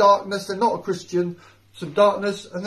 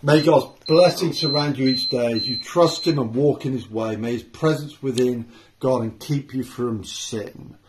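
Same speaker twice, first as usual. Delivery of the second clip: benediction; 7 semitones lower than usual. Real, not acted. Closing benediction.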